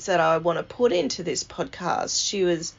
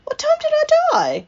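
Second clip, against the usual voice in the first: very high, loud, fast imitation; Nan's insistent question; 7 dB louder, faster than usual. Nan to nurses after admiring heaven, high insistent asking. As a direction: imitate Nan repeating it in a squeal.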